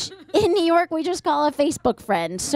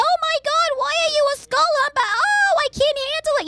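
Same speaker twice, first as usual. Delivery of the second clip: high, fast, panicked mother; hysterical mom; much faster than usual. Pretend. Mother hearing her son's news, high panicked shriek. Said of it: "And I'll be like".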